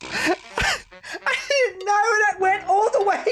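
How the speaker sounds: laugh-crying. Real, not acted. Item went back to base.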